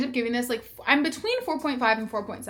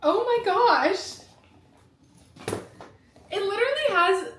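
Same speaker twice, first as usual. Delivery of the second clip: near tears; 9 semitones higher than usual. Real, not acted. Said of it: "I literally just said I wasn't gonna cry".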